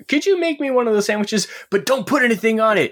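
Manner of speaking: high, fussy customer asking; picky customer voice. Retold or imitated. Acting out a picky sandwich customer.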